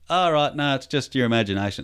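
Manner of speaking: high, loud, acted brush-off; hasty reassurance. Dad dismisses son's sighting, overbright acted reassurance. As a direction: reassure too keenly, starting high then easing down.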